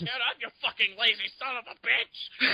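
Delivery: high-pitched hammed-up trainer voice; Mickey. Joining in as Kong's cornerman, mock rousing yell. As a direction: goad a downed fighter to rise. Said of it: "'Cause Mickey loves ya".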